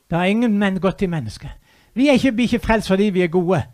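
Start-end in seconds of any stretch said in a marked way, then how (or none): none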